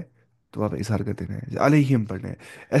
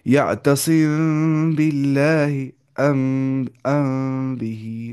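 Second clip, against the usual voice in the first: slow, drawn-out Quranic recitation; slow demo recitation; 2 semitones higher, much slower than usual. Shown as a demonstration. Teacher slowly reciting an example for students, drawn out. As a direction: stretch each sound so the lips can be heard closing. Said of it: "इस वाली इख्फा को देखिए".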